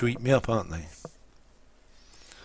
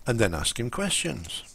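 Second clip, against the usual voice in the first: ordinary talk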